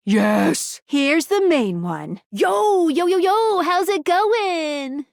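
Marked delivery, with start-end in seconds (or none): 2.3-5.1 high, drawn-out hype hello; over-eager greeting. Acted. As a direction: sing out a playful hello.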